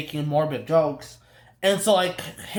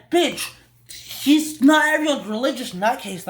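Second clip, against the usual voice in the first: higher, louder indignant rant; venting at the mother, pitched up and loud; exasperated outburst; 8 semitones higher, 4 dB louder than usual. Real, not acted. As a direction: snap back in disbelief. Ranting at a film character.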